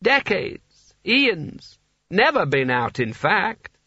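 put-on high, very slow, dreamy old ruler; tipsy old Seraph. A narrator's reading. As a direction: drift through each word like a sleepy drunk. Seraph rambling on.

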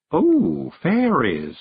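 dazed, drawn-out little exclamation, slightly high; Miss Level mumbling. A narrator's reading. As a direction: marvel woozily at the sight. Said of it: "she mumbled".

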